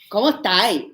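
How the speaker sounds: "¿Cómo estás?" shortened to "cómo tai"; emphatic "cómo tai", no s at the end; "estás" reduced to "tai"; Chilean colloquial form. Retold or imitated. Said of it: "she uses the Chilean expressions".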